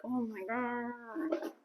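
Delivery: slow, quiet drawn-out groan; exasperated drawl. Shown as a demonstration. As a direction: stretch it out, worn out. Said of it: "if this was me in real life".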